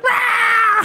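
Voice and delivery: loud, very high goat scream; goat bleat. Acted. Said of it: "the goat bleats are like".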